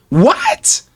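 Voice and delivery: sharp, high-pitched disbelieving what; a sudden, squeaky jump in pitch on the single word; shocked high what. Retold or imitated. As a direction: replay the shocked what from the hospital. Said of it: "I said that the same way I said it here".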